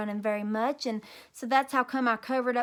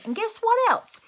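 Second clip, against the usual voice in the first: much higher and louder; excited boast; 11 semitones higher, 6 dB louder than usual. A narrator's reading. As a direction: tease the news to the class. Girl boasting to class.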